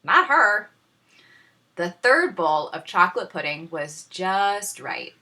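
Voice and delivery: plain reading voice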